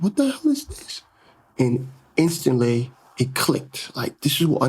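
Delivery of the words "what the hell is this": high, incredulous exclamation; shocked disbelief. Retold or imitated. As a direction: react in stunned disbelief. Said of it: "And I'm like".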